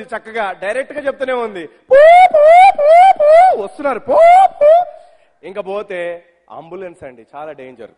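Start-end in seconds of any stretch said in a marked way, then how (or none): 1.7-4.7 high loud wailing siren sound; police siren. Retold or imitated. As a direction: wail it like a siren. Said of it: "ఆ jeep చెప్తూనే ఉంది". (Police siren లా అరుపు)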